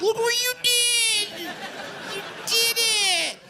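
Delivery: very high, slow mock praise; praising the dog. Owner praising the dog's dump, very high and slow. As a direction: celebrate the dump like a triumph. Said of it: "I'm like".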